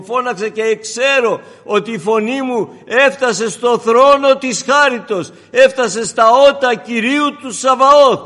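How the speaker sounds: loud, ringing proclamation; full-force preaching. Acted. Preacher proclaims to the congregation, loud and fervent. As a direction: thunder it to the church.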